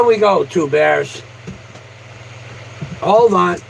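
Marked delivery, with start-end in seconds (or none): none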